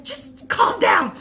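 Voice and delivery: high, slow mock soothing voice; friend calming her. Friend in the theater hushing her excited seatmate, high slow mock soothing. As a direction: imitate a friend telling you to settle down, slowly and high. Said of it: "She's like".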